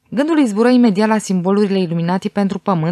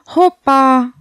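high, louder burst of discovery; exclamation; 6 semitones higher, 5 dB louder than usual. A narrator's reading. Victoria spotting something on the page, a raised exclamation. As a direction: call it out higher and louder. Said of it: "exclamă Victoria".

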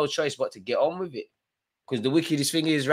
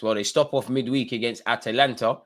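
usual speaking voice

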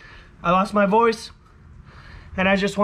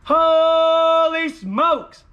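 excited shout; 6 semitones higher, 4 dB louder, much slower than usual. Real, not acted. Celebrating again.

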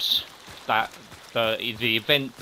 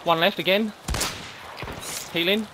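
excited callout; 6 semitones higher than usual. Real, not acted. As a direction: shout the kills out in triumph. Kill callouts in firefight.

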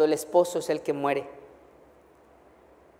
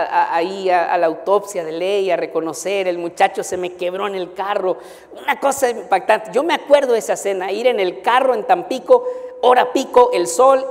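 usual speaking voice

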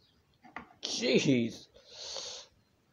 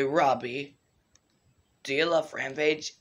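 ordinary talk, nothing marked

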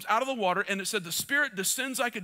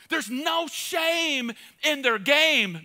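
very slow, higher; slow emphasis; 4 semitones higher, much slower than usual. Acted. Preacher to the men, slow emphatic. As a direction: draw out every word.

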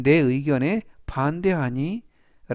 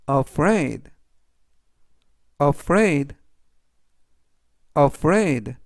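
careful, spaced-out vocabulary drill; slow model reading; 4 dB louder, much slower than usual. Shown as a demonstration. Teacher reviewing words for learners to repeat, slow careful reading. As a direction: read it three times, leaving room to repeat. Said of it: "제가 천천히 세 번씩 읽어드리고요".

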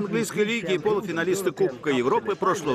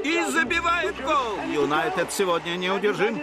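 frantic mock sports commentary; 5 semitones higher than usual. Radio match commentary over music.

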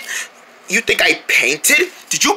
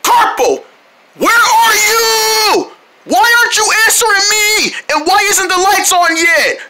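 angry yelling; 12 semitones higher than usual. Real, not acted. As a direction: shout demands into the dark. Calling angrily in dark.

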